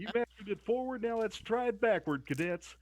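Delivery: high barking drill sergeant voice; drill instructor. Pretend. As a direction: bark orders at the cadets. Drill instructor addressing cadets.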